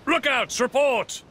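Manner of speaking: high, loud call up to the lookouts; captain calling the lookouts to report. Acted. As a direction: shout up the mast.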